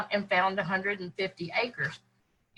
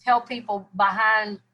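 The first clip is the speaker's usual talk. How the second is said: ordinary delivery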